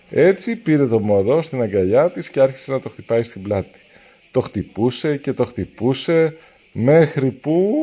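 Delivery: ordinary delivery, nothing marked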